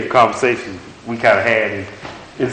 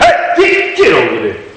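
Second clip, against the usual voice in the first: yelling coach; 6 dB louder than usual. Acted. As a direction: holler an order like a coach. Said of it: "talk to you like a coach".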